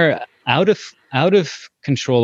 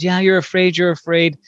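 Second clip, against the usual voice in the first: soothing empathy; 7 semitones higher than usual. Retold or imitated. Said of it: "I said".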